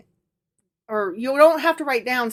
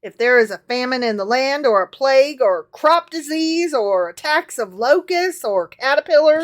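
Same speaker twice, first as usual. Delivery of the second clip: scripture reading; 3 semitones higher, 7 dB louder than usual. Acted. Reads scripture aloud.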